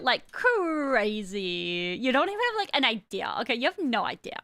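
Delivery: put-on valley girl, stretched vowels and swinging pitch. Shown as a demonstration. Streamer thanks viewers for the biddies, hammed-up valley girl. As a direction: gush like a valley girl, dragging out key words. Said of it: "warm up the valley girl".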